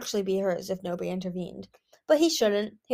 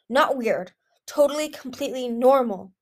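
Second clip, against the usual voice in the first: higher, louder urgent insistence; barked order; 4 semitones higher, 6 dB louder than usual. A narrator's reading. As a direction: drive each word home as an order. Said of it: "listener barked".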